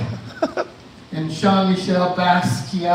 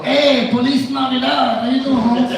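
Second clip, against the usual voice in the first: high, slow put-on homie voice; mock gang greeting; 6 semitones higher than usual. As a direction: greet the gang like one of them, drawn out. Cop greeting at gang party.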